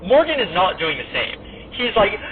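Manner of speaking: usual speaking voice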